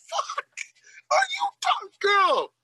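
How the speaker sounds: high-pitched squeal of disbelief; mock outrage. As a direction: react in hammed-up shock, voice shooting up. Acted outraged reaction to lover's voice.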